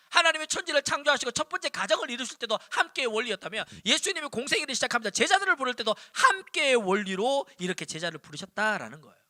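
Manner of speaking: ordinary talk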